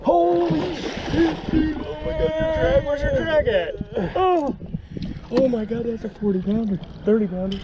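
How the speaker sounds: high, loud excited yelling; excited shouting. Real, not acted. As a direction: yell it out in thrilled disbelief. Huge catfish landing.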